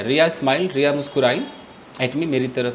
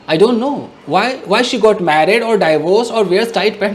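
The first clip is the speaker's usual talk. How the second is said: loud, drawn-out angry reply; angry retort; 5 semitones higher, 6 dB louder, much slower than usual. Retold or imitated. Son angrily answering mother about the guest's clothes. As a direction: let the anger out, slowly and loud. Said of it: "गुस्सा आ गया".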